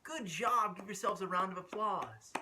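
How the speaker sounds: ordinary delivery, nothing marked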